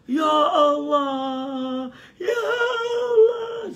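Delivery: hammed-up high wailing shout, long drawn out; mock a screaming prayer gathering. Preacher mimics prayer gatherings that scream, high drawn-out wail. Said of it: "majlis-majlis berdoa".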